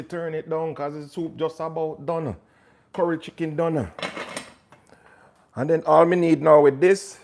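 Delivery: high, lilting Jamaican patois; Jamaican accent. Acted. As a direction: say it like a Jamaican cook. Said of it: "This part is not Jamaican".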